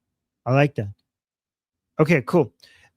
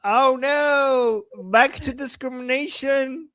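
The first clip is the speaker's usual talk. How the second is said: mock victimhood; 8 semitones higher, 4 dB louder than usual. Mock victimhood after being moderated.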